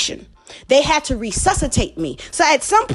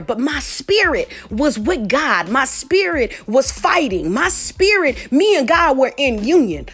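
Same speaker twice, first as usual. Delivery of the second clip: slow emphatic; slower than usual. Real, not acted. Preaching climax, slowed.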